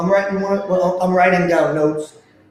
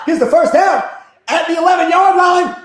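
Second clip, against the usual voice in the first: excited shouting; 12 semitones higher, 5 dB louder than usual. Real, not acted. Live game call, first down.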